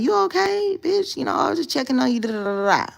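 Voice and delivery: high, loud, fast friend check-in; worried friend. Retold or imitated. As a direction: squeal it like a frantic friend on the phone. Friend blowing up her phone.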